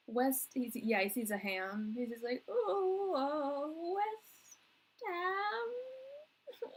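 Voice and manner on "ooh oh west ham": high, drawn-out mock football chant; football fan. Sitcom character seeing a ham, high mock football-fan cry. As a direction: wail the team name like a devoted fan. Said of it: "talk like a football fan".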